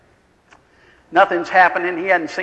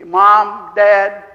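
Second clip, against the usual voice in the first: desperate calling out; 3 semitones higher, 7 dB louder than usual. Retold or imitated. Dying son calls for parents.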